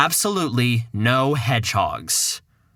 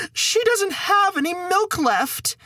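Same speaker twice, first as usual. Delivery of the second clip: higher, slow hammed-up sobbing; mock wail; slower than usual. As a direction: overplay the mother's crying. Mother wails about cow.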